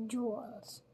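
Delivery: usual speaking voice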